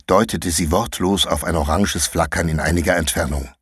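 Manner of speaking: plain reading voice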